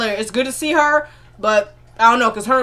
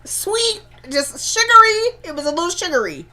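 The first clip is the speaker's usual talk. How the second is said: sweeten it way up, teasingly sugary; mock sugary lilt, pitched way up; 7 semitones higher than usual. Shown as a demonstration. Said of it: "How he said it, y'all, was a little sweet".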